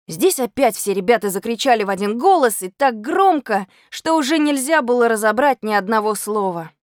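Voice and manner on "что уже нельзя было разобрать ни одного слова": ordinary delivery, nothing marked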